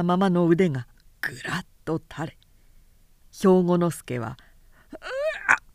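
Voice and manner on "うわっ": high sudden cry of pain; pained scream. Acted. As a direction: yelp as the broken arm drops. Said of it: "と悲鳴を上げた". (痛みの悲鳴)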